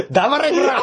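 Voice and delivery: high, laughing fake yell; mock angry yell. Teased about a nerdy voice, he fires back in a high, laughing mock yell. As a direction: bark it back as a joke, laughing. Joking comeback after nerd-voice remark.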